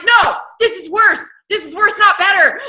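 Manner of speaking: loud, high, laughing protest; exasperated outcry. Real, not acted. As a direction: wail it in half-laughing outrage. Outraged at the pregnancy.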